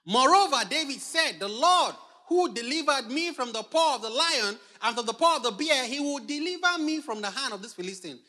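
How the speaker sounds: loud, high scripture proclamation; forceful declaration. Acted. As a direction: proclaim it with full preacher's conviction.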